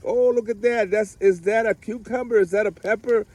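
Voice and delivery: high, eager mock-kid questioning; excited kids. Kids spotting the first fruit on their plants, excited. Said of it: "they're, like, so excited".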